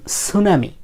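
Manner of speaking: সুনামি starting straight on the s; silent T. Shown as a demonstration. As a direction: say সুনামি slowly, with no t before the s. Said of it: "টি এর উচ্চারণ হয় না".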